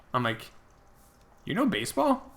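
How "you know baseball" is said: high, amazed question; surprised. Retold or imitated. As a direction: sound genuinely taken aback. Surprised a stranger knows baseball.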